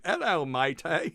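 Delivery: loud, high, drawn-out Cockney-style greeting; hammed-up British accent, slow and loud; mock British accent. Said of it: "foreign accent syndrome".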